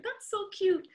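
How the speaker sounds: high, delighted squeal of cute; sudden high-pitched delight at a sweet name; gushing. Real, not acted. Gushing over a wine name.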